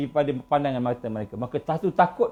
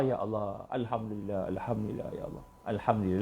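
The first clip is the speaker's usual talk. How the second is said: very soft, low murmured prayer; whispered thanks; 7 semitones lower, 10 dB quieter than usual. Pretend. Grateful believer thanking God in his heart, murmured. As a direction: breathe the thanks almost silently.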